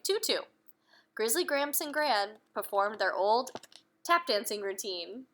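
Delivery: put-on reading aloud to children; lively bedtime-story narration; storybook reading. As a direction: tell the story to little ones. Reading a picture book aloud.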